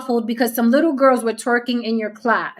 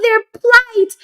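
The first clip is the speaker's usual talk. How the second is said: mock pity; 12 semitones higher, 6 dB louder than usual. Mocking the girls' plight.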